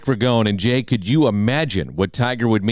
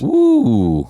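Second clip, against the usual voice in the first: long, high, louder "ooh"; intrigued ooh; 4 semitones higher, 5 dB louder than usual. Real, not acted. Co-host reacting to a guess at Tiger's score, intrigued ooh. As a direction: let out a drawn-out, high ooh.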